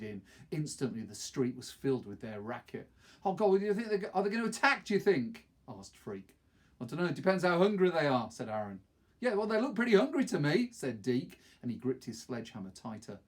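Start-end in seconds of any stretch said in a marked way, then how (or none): 3.2-5.5 put-on high, loud, anxious boy's voice; worried, voice jumping up; scared question. Frightened boy asks about dogs. 6.8-8.3 casual reply. Acted. Aaron answers, voiced character. 9.2-10.7 high, loud and fast, uneasy; nervous remark. Acted. Deke nervous about dogs.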